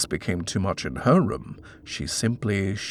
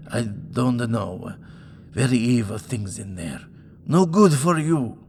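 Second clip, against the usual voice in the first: fearful landlady warning of evil presences; superstitious foreign landlady; 4 semitones higher, 3 dB louder than usual. Acted. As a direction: warn him darkly that the house is cursed. Laristi warns of evil.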